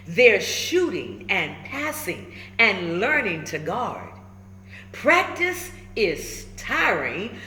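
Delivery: put-on lively, rhyming read-aloud for kids; storybook read-aloud. A narrator's reading. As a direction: bounce the rhymes like a picture book. Reads picture book aloud.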